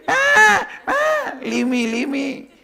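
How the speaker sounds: high, loud goat bleat on each word; bleating sheep. Acted. Cub caught by lion bleats to be let go. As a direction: bleat it like a panicked little goat. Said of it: "started bleating same way".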